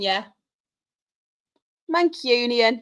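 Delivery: ordinary talk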